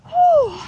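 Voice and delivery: loud, high breathless whew; winded whew. Real, not acted. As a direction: blow out a tired whew. Exhale after steep climb.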